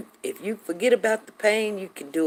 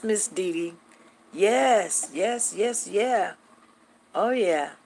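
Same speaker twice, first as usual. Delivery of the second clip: usual speaking voice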